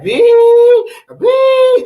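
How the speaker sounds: long, high squealed word. Shown as a demonstration. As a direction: stretch it out high and loud, showing off the slang. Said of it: "That's the language that they speak".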